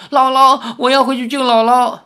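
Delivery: put-on high, loud tearful plea of a young girl; crying girl. A narrator's reading. Girl crying to Li Xiaoyao to save grandma, high tearful voice. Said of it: "赵灵儿哭着说".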